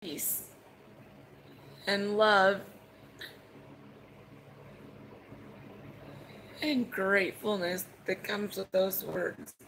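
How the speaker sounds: fighting back tears while telling about mom's call; choked up, emotional. Real, not acted. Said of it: "I'm sorry if I get emotional".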